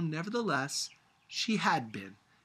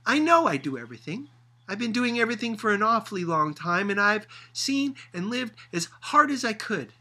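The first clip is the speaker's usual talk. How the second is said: very upset; 7 semitones higher, 8 dB louder than usual. A narrator's reading. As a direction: insist on finishing, upset. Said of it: "She was very upset".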